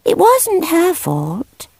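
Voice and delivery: put-on high, slow child's voice; earnest young girl pleading for the cat; little girl Debbie. A narrator's reading. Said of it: "Debbie said".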